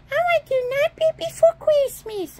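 high, quick squeaky puppet; toy dragon voice. Pretend. Dragon asking for Nightmare Before Christmas, high quick puppet voice. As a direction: rattle it off as a squeaky pretend dragon. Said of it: "Hi, Dragon".

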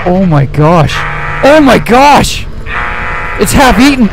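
high, loud panicked screaming; scared horror game streamer. Real, not acted. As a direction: panic as the monster chases you. Monster breaks in, chases him.

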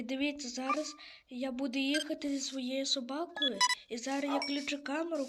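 usual speaking voice

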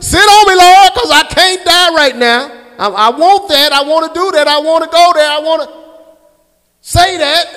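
high, loud crying out to the Lord; pleading shout to the Lord; pleading prayer. Pretend. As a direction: beg God loudly to hold you down. Preacher acts out his own prayer.

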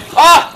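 loud, high, sudden scream of fright; startled scream. Real, not acted. Gamer ambushed in his base, shrieking. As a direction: shriek at the jump scare.

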